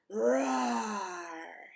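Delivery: a big loud playful roar; lion roar. Shown as a demonstration. Reading to a child, she roars like a lion. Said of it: "make a sound like a lion".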